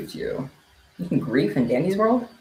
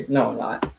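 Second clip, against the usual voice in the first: ordinary talk, nothing marked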